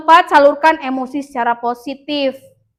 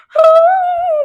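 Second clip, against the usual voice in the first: very high, loud indignant huff; sulky huff; 9 dB louder than usual. Retold or imitated. Embarrassed pupil reacting badly, loud sulky huff. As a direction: blow out an offended huff. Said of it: "terus kayak gitu".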